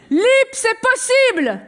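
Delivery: loud, high, slow chant-like shout; rallying slogan shout. Acted. Worker-priest addresses the Lip assembly, rallying shout. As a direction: proclaim it to rouse nine hundred workers. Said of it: "je suis Jean Ragnès".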